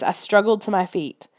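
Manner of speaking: ordinary delivery, nothing marked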